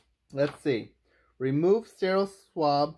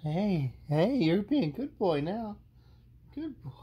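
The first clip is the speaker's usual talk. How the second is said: pet praise voice; 6 semitones higher than usual. Real, not acted. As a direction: praise the dog gently. Praising dog.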